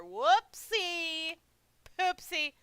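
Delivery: high, loud singsong whoopsie; mock-cute yelp at a blunder; playful whoops. Streamer joking about the hero stepping on a can, playful whoops. Comic outburst at the clumsy slip.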